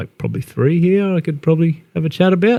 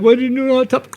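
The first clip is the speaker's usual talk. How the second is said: much higher, mouth full of lollipop; 10 semitones higher than usual. Retold or imitated. Angry taxi driver at hilltop, garbled through a lollipop. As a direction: mimic the driver mumbling round a lollipop. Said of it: "Sucking on the lollipop".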